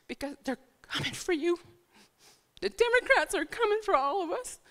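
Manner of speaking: pleading while choking back tears; emotional, near tears. Real, not acted. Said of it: "I'm sorry to get emotional".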